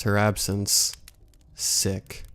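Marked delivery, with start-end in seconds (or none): 1.5-2.2 soft, unvoiced one-word reply; curt and quiet. A narrator's reading. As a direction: drop it flatly, almost whispered. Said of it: "the girl said simply".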